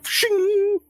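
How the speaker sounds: very high ringing blade noise; knife-drawing sound. Acted. Scrawny man takes out a knife, high ringing shing. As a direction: sing out a metallic shing. Imitates a knife being drawn.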